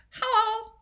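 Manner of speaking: a very high squeaky "Hello."; high voice demo. Shown as a demonstration. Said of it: "High, as in".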